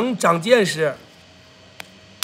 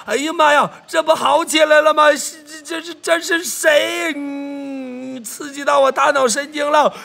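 rapid repeated whoops climbing higher; high, loud livestream frenzy; overexcited gift shout-out; 7 semitones higher, 4 dB louder than usual. Real, not acted. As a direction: shriek thanks for a big gift. Overwhelmed by a big gift.

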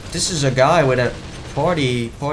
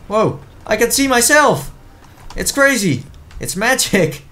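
high, louder amazement; excited; 11 semitones higher, 4 dB louder than usual. Real, not acted. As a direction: gush with wonder. Amazed at seeing himself.

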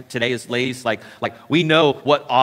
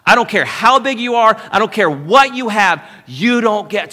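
higher and louder, a defiant preacher's roar; defiant shout; 5 semitones higher, 6 dB louder than usual. Acted. David defying the giant Goliath, loud defiant shout. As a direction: thunder it back at the giant like David.

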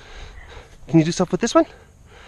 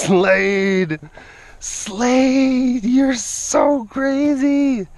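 squealing high and drawn out; a high, stretched-out cry of delight at the tiger's antics; high, drawn-out playful scolding; high, slow exclaiming; 9 semitones higher, much slower than usual. Real, not acted.

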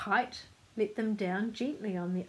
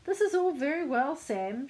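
raised storybook shout, easing off; shouting to him; 7 semitones higher, 5 dB louder than usual. A narrator's reading. Mother to Sam on the kite, shouting. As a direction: call out over the wind. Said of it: "she shouted to him".

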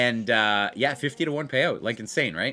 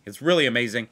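ordinary delivery

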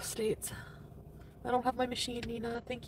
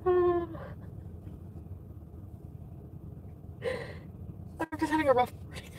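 emotional; 6 semitones higher than usual. Real, not acted. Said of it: "that makes me so emotional".

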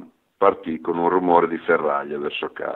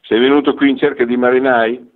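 a stern, pointed question, pitched up and louder; 5 semitones higher, 4 dB louder than usual. A narrator's reading. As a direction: press him sternly. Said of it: "diceva severo".

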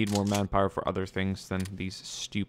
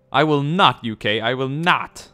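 loud, raised-pitch play outrage; mock defiant refusal; 5 semitones higher, 11 dB louder than usual. Player refusing the UK, mock-defiant and loud. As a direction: hammer out a hammed-up refusal.

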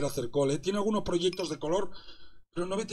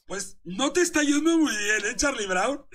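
higher and louder teasing jab; mocking taunt; 6 semitones higher, 6 dB louder than usual. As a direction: rub it in, playful and loud. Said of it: "te estoy troleando". (burla aguda y fuerte, tono de troleo)

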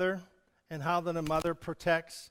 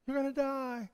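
echoed threat; 6 semitones higher, 5 dB quieter than usual. Acted. Echo, far voice.

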